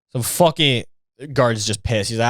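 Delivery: ordinary talk, nothing marked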